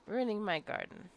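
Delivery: normal talking voice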